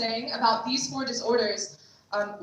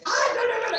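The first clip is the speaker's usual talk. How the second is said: mock scream; 10 semitones higher, faster than usual. Survivor refusing the offer of food, in a mock scream. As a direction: imitate a panicked no, pitched high. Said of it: "she starts screaming".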